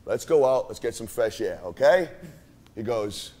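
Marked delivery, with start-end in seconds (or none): 0.0-2.1 soft coaxing. Retold or imitated. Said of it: "I go".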